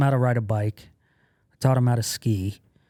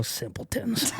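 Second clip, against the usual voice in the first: mimicking under breath; 9 semitones higher, 8 dB quieter, slower than usual. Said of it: "So I would just look away and go".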